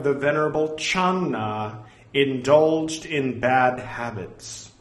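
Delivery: slow, measured recitation; solemn, carefully spaced reading aloud; formal scripture reading. Acted. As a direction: read out the sacred text deliberately.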